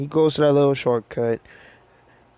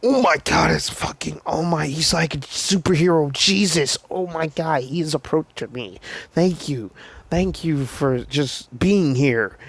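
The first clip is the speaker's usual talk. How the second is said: acted starstruck gushing, pitched up; overwhelmed fan barely believing it; losing it; 3 semitones higher than usual. Deku in his mind as All Might approaches, hammed-up losing it. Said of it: "He's fucking losing his shit".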